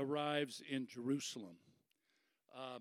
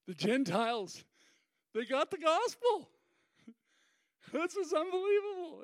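high, loud, gleeful announcement; acted elation; 12 semitones higher, 6 dB louder than usual. Peter bringing the Gentile news to Jerusalem, elated. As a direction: burst out with amazed joy.

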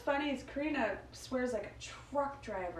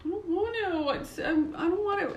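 put-on higher, whiny-edged little kid refusal; lightly voiced small child balking; reluctant little boy; 9 semitones higher than usual. Grandson balking at holding the flowers, mock reluctant kid voice. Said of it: "and he's like this".

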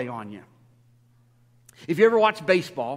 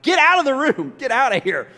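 much higher and louder, playful shooing; exasperated shout; 8 semitones higher, 9 dB louder than usual. Retold or imitated. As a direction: chase the doubter out of the room. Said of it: "I'm like".

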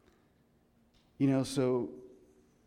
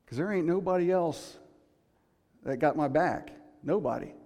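higher, louder mock defiance; defiant boast; 4 semitones higher, 5 dB louder than usual. Preacher voicing the rich farmer, mock defiant and louder. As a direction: puff up like the selfish farmer.

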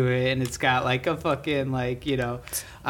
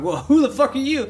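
startled yell; 11 semitones higher, 4 dB louder than usual. Retold or imitated. Startled at stranger from darkness.

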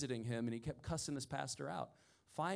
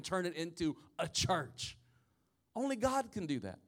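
emphatic preaching; 5 semitones higher, 3 dB louder than usual. Real, not acted. Preacher telling the church only God can turn money into people, emphatic preaching. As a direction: preach it with ringing conviction.

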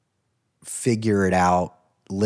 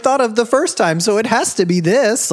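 mocking stubborn voice; 11 semitones higher, 6 dB louder, faster than usual. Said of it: "Like, no, it doesn't".

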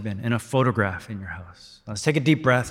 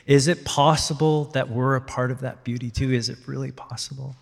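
awed hush; 4 dB quieter than usual. Acted. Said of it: "The poet is saying".

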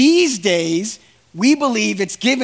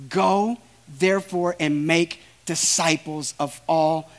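softer, reverent reading voice; scripture reading; 6 semitones lower, 5 dB quieter than usual. Acted. Preacher reading the Great Commission aloud, hushed and reverent. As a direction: read the verse with quiet reverence.

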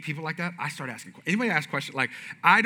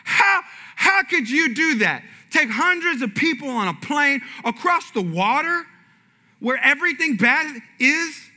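slow, high disbelief; incredulous; 6 semitones higher, 3 dB louder than usual. Retold or imitated. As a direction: act out being amazed anyone dares fly. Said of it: "I said".

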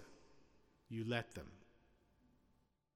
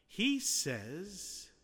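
normal talking voice

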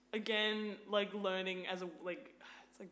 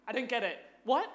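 loud, playing dumb; mock confused confrontation; 5 semitones higher, 11 dB louder than usual. Woman confronting catcaller, loud feigned confusion. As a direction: demand they explain it, loud. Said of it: "And I was like".

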